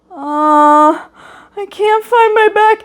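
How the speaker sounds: put-on high, whiny little-kid voice; a lost first grader on the verge of tears; imitating a distraught six-year-old; upset child. Lost child retelling backpack loss.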